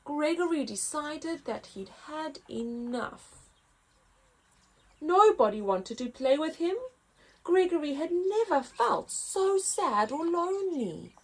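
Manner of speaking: lively picture book read-aloud; story narration. A narrator's reading. Narrator reading to children, lively.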